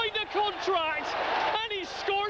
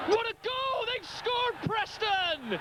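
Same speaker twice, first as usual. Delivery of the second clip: excited goal call; 3 semitones higher than usual. Real, not acted. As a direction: shout it as the ball goes in. Commentator calls a goal.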